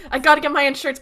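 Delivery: high, loud mocking imitation; hammed-up urgent insistence; mock urgency. Mocking John's urgent insurance request.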